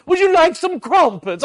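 a dad voicing his little daughter as a grand English hostess, high and loud; hammed-up English hostess. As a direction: play the grand lady of the house. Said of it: "And she said".

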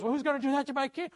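high, fast indignant outburst; hammed-up royal outrage, rushed; mad king. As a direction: demand it as an angry king. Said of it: "And king gets mad".

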